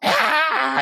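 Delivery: high-pitched mock "Ah!" yell; jump-scare yell. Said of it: "jumps out of the bushes and goes".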